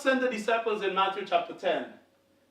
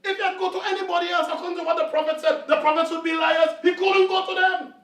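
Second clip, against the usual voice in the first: high, loud, fast preaching; full-force preaching; 7 semitones higher, 5 dB louder than usual. Real, not acted. Preacher arguing to the congregation, loud and fast. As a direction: drive the argument home. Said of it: "preaching at full force".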